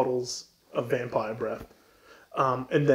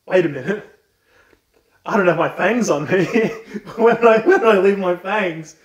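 laughing count; 4 semitones higher, 5 dB louder than usual. Acted. Count voiced, forgetting his fangs.

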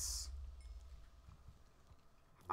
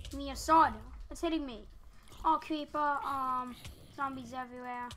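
real panicked shouts; 2 semitones higher, 8 dB louder than usual. Real, not acted. Player under attack calling for a sword, panicked. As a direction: shout as the mobs close in.

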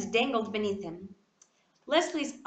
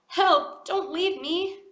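desperate plea; 7 semitones higher, 5 dB louder, slower than usual. A narrator's reading. Boy left hanging, begging.

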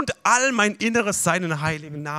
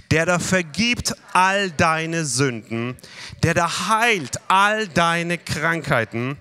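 preacher leads the church through Psalm 103, reciting slowly; slow recitation; slower than usual. Shown as a demonstration. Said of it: "Alle zusammen".

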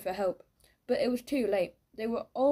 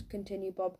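ordinary delivery

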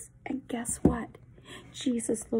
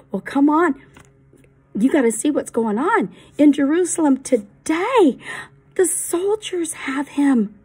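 louder, pressing him to come along; urgent call; 2 semitones higher, 8 dB louder than usual. Acted. Eli urges Benjamin to come to Jerusalem, loud and pressing. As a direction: hurry your friend along.

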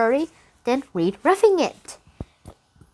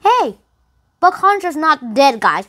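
high, loud excited shout of news; excited call; 6 semitones higher, 6 dB louder than usual. Retold or imitated. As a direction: burst in with surprising news. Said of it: "was like".

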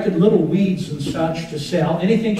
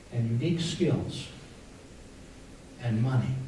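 hushed solemnity; 5 semitones lower, 14 dB quieter than usual. Real, not acted. Preacher asking listeners how God feels, hushed and solemn. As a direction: let each phrase sink in softly.

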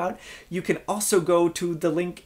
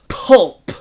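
"Pulp" punched louder; harsh pulp; 4 semitones higher, 8 dB louder than usual. Shown as a demonstration. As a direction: spit "Pulp" out hard. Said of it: "Just listen to the word".

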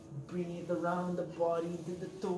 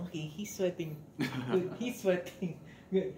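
amused inner voice; 9 dB louder than usual. Retold or imitated. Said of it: "I just say".

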